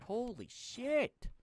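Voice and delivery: high, drawn-out exclamation of dismay; exasperated outburst. Real, not acted. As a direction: stretch it out and let it leap upward. Exasperated at the disorganized storage.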